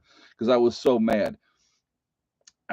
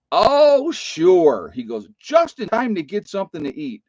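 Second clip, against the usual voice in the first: sarcastic grandpa; 8 semitones higher, 6 dB louder than usual. Retold or imitated. Grandfather's sarcastic greeting retold.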